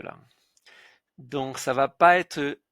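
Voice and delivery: ordinary talk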